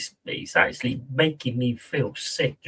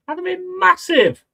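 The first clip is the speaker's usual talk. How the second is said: mock high boast. Real, not acted.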